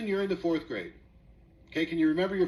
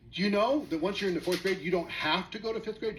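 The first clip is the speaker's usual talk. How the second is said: ordinary talk, nothing marked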